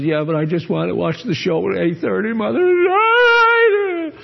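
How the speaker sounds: put-on child pleading, voice jumping high on Mother; whiny child. Child answering mother about the show, whiny pleading. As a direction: play the kid begging to stay up. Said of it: "the child says".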